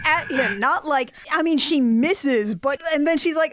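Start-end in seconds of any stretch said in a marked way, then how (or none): none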